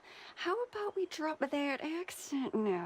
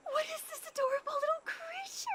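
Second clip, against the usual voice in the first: much higher, fast, squealing coo; gushing delight; 11 semitones higher, faster than usual. Acted. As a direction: coo over a cute pet. Sees cute creature.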